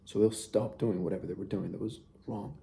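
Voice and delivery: ordinary talk, nothing marked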